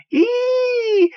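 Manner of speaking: very high squeak, mocking a tiny man; imitating a squeaky voice. Antonio mimics the little stranger's voice, very high squeak. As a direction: squeal it like a canary swallowed by a witch. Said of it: "he spoke like this, very high".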